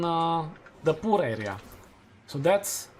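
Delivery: ordinary talk, nothing marked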